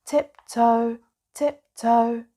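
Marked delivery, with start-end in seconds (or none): none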